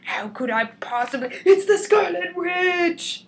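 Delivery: hammed-up shock building to a shout; alarmed villain. Scientist misfiring, panicked cry of alarm. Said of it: "And he's all".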